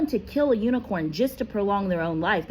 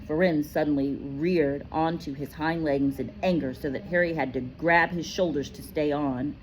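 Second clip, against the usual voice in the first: plain reading voice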